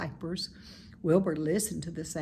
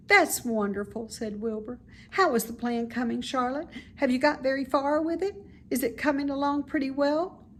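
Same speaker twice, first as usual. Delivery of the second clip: higher, quicker pig voice, eager; eager young pig, pitched up; anxious little pig; 7 semitones higher, 4 dB louder than usual. Acted. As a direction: press her for news, nervous.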